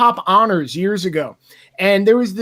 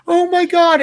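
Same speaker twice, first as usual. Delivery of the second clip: gushing stranger; 8 semitones higher, 4 dB louder than usual. Retold or imitated. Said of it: "just went".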